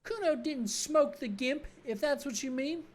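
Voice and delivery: high, slow, sneering brat voice; bratty kid Kuno. Acted. Cocky kid mocking the cop, in a high bratty voice.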